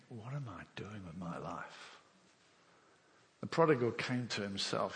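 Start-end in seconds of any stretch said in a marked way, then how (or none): 0.1-2.0 low, hushed question to yourself; quiet soul-searching. Acted. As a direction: think it aloud, much softer. Voicing a moment of self-realisation.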